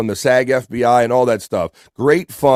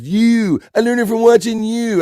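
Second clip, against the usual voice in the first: high-pitched mock-dramatic line reading; classic commercial quote; 10 semitones higher than usual. Quoting a classic commercial from a chat message, mock-dramatic. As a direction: act out the old ad line, pitched way up. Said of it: "That's a classic commercial".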